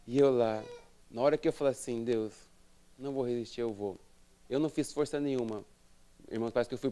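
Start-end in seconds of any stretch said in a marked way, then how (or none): 2.0-4.0 low, soft private prayer; hushed, yielding admission; quiet surrender. Retold or imitated. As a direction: give in softly to God. Retold surrender prayer to God.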